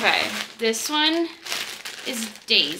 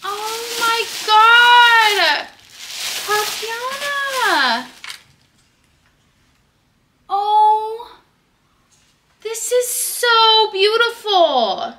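much higher and louder, slow gasping joy; overwhelmed delight; 12 semitones higher, 4 dB louder, much slower than usual. Real, not acted. Seeing Tatiana's gift, high, loud, overwhelmed joy. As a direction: squeal it out, stunned by the gift.

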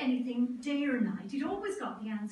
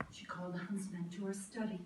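ordinary delivery

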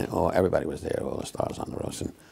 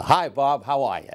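raised, hearty stage hello; showy entrance greeting; 5 semitones higher than usual. Retold or imitated. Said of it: "how to make an entrance".

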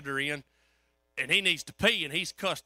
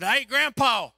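calling out; 5 semitones higher, 8 dB louder, slower than usual. Retold or imitated. Calling into dark hospital room.

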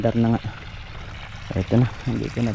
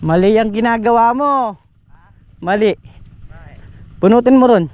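raised voice calling across the field, correcting; 8 semitones higher, 4 dB louder than usual. Real, not acted. Farmer calling to Makmak across the field, loud correcting call. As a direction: call out the correction so he hears you.

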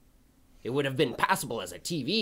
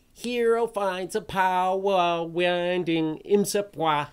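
mocking cliché; 4 semitones higher, slower than usual.

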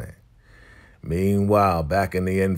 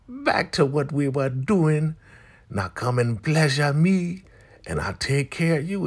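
higher-pitched put-on woman propositioning; mock seductive demand; 8 semitones higher than usual. Elder mother ordering Manny to pleasure her, mock seductive.

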